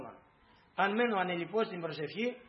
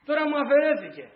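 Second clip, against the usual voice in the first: anguished cry; 7 semitones higher, 6 dB louder than usual. Acted. Grieving mother cries to God.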